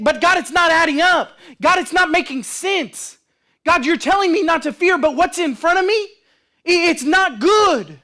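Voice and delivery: high, insistent objection to God; worked-up, pleading complaint; pleading protest. Acted.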